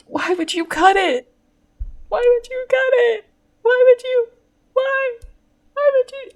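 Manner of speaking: very high, squeaky put-on voice; mock questioning. Host replays "why would you cut it", in a squeaky mock voice. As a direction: plead it in a tiny falsetto.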